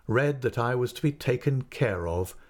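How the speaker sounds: ordinary delivery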